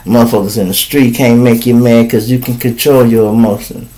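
usual speaking voice

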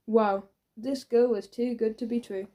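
plain reading voice

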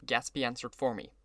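ordinary delivery, nothing marked

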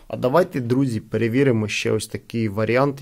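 ordinary talk, nothing marked

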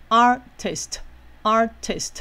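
"artist" in two slow beats with a gap in the middle; split in the middle. Shown as a demonstration. Teacher showing where the word divides, slow two-part "artist". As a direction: break "artist" cleanly in half, slowly, each half on its own. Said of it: "就中间这样子分".

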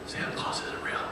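hushed, conspiratorial whisper; whispered secret. Retold or imitated. Eight-year-old tells five-year-old about Santa, whispering. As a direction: whisper it like a kid passing on forbidden knowledge.

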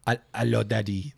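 put-on low, soft French-accented greeting. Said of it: "they have a French accent".

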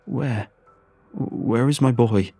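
breathless desperate father. Acted. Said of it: "shaking with breathlessness".

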